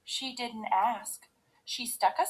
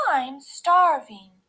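high, loud, slow mother-bear voice; Mommy Bear; 4 semitones higher, 8 dB louder, slower than usual. A narrator's reading. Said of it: "said Mommy Bear".

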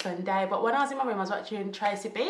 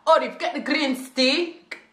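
higher, louder, slow mock-menacing mum; stern mum; 5 semitones higher, 7 dB louder, slower than usual. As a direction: threaten the kid like a strict African mum. Mum threatening with the stick.

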